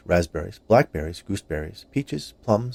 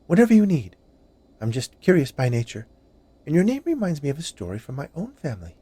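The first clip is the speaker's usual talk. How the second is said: higher, chatty sweet woman; woman's voice; 7 semitones higher, 4 dB louder than usual. A narrator's reading. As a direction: play the friendly baker lady.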